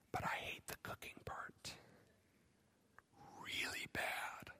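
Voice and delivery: hushed whisper, slow at the end; mock secret whisper; whispered confession. Wife voiced confessing, whispered.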